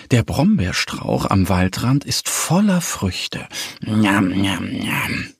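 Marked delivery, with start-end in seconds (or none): 3.8-5.3 low munching noises; munch low like a greedy little bear; smacking munching. A narrator's reading. Little bear eats blackberries, smacking. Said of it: "schmatzt der kleine Bär".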